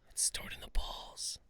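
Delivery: breathy stage whisper. Acted. Joking about chi, hushed whisper. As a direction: whisper it like a secret.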